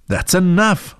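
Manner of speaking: high-pitched motherly cut-in; interrupting. A narrator's reading. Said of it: "Mom interrupted".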